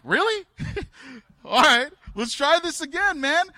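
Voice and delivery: high, loud, disbelieving "Really?" breaking into a laugh; incredulous. Retold or imitated. Pastor retelling his disbelief at the healed boy, high and incredulous. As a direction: play it like you can't believe it worked. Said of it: "And I'm like".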